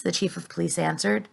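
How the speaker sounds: ordinary delivery, nothing marked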